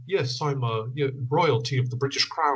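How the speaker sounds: hint of a posh British lord accent; lightly hammed-up posh British accent; prim proper British. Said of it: "prim proper".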